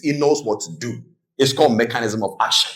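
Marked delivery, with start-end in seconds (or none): none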